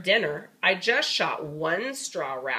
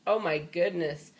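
ordinary delivery

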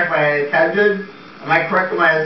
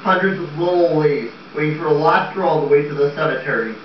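normal talking voice

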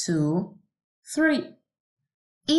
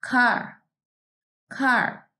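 said higher before the hum; careful word call; 6 semitones higher than usual. Shown as a demonstration. Teacher calls car before humming. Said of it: "let's try humming the words".

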